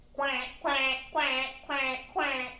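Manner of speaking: loud, even quacking; loud mallard quacks; duck quack. A narrator's reading. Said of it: "quacking five times in agreement".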